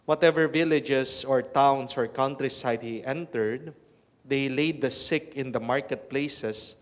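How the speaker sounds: loud, solemn reading from the pulpit; Gospel proclamation. Acted. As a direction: proclaim it to the whole congregation.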